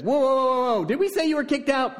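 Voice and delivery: mock eager backpedal. Family welcomes Jephthah back.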